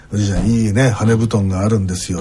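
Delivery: playing a sly salesman who flatters a grandpa; smooth salesman to grandpa. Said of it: "とかって言いながら".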